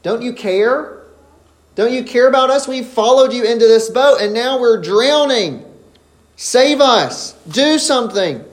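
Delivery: high, loud desperate reproach; accusing cry. Retold or imitated. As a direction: blame him as the boat sinks. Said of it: "They are, in effect, accusing him".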